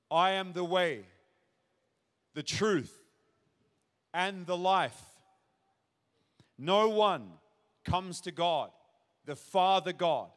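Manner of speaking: slow, deliberate Bible reading with long pauses; firm proclamation, each phrase standing alone; declarative scripture reading. Real, not acted. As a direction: proclaim it in separate weighty phrases. First solemn reading of the verse.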